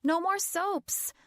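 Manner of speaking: high slow shout. A narrator's reading. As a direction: yell it out in protest. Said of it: "I shouted".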